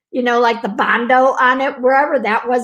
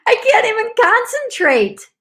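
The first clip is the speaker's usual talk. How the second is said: high, helpless laughing protest; hammed-up helpless giggly wail; laughing and screaming; 13 semitones higher than usual. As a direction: squeal it through laughter. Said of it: "I was laughing and I was screaming".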